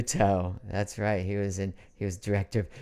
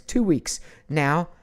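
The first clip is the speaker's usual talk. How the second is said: ordinary talk, nothing marked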